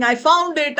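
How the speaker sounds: high joyful cry; excited. Acted. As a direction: shout it with delight. Running home after finding diamond.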